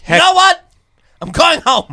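loud, exasperated threat to leave; fed-up shout. Real, not acted. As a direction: storm off in a huff. Angry walkout threat.

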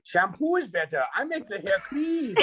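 high, slow put-on accent; goofy accented Sandler character; Sandler accent bit. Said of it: "Adam Sandler impression contest".

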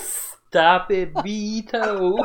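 high, giggling protest; laughing. Real, not acted. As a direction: beg him to stop while laughing. Cracking up at the ad.

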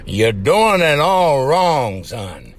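slow, drawn-out lecturing drawl; lightly voiced cartoon scolding, slow; mild cartoon drawl, son dropped low; cartoon rooster quote. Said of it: "that cartoon cracked me up".

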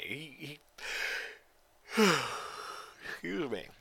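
talking through a yawn. Real, not acted. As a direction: yawn mid-sentence, then excuse yourself. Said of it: "I keep yawning".

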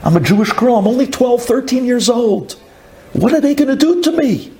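put-on rising, high, desperate cry of a young girl; frightened girl pleading. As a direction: let her voice climb in panic. Captive girl pleads, crying.

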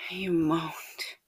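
ordinary delivery, nothing marked

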